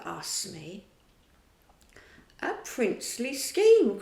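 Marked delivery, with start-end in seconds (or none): 2.4-4.0 higher, louder delighted exclamation; the captain delighted with the plan; cried out. A narrator's reading. Hook cries out in delight at Smee's idea. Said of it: "cried Hook".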